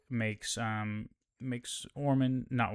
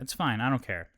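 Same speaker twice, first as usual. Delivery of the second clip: usual speaking voice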